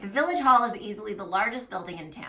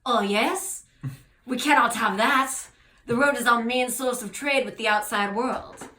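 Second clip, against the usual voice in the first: high, loud French clerk, emphatic; put-on French accent, insisting; 4 semitones higher, 7 dB louder than usual. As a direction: object firmly as a French official. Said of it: "oh, he's French".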